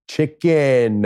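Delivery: loud, drawn-out word pitched high; obnoxious American tourist. Retold or imitated. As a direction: overenunciate it slowly at someone who can't understand. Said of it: "I'm that American guy saying".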